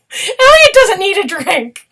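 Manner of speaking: high, loud, drawn-out outburst; imitated comic character. Fan quoting a favourite funny line from the show, in a loud, high put-on voice. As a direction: belt it out high and slow in a put-on voice.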